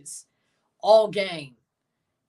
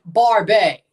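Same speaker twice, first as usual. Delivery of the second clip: calling out loud; 8 dB louder than usual. Real, not acted. Calling out to friend mid-game.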